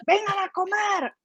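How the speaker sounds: put-on quick, high call to the kids in Spanish; everyday Spanish call. Shown as a demonstration. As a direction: rattle off "Vengan a comer" high and brisk. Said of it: "In Spanish".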